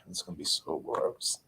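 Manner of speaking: whispered to herself; breathy whisper of disgust. Real, not acted. Repeated line whispered.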